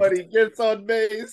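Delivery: high, drawn-out meme quote. Retold or imitated. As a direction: quote the meme high, stretching the last phrase. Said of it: "it's like that Wander Franco meme".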